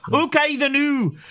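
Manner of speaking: high-pitched caricature Scottish; Scottish accent. Acted. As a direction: put on a high Scottish lilt. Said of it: "the Scottish accent".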